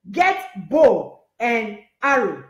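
"Get bow and arrow." drawn out very slowly, each word weighed; slow emphatic command. Shown as a demonstration. Preacher repeating the prophet's order to the church, very slow and weighty. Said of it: "Elisha said".

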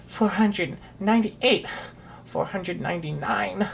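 weary, puffing count; tired voice. A narrator's reading. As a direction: count on, winded from the climb. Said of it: "broke in the tired voice".

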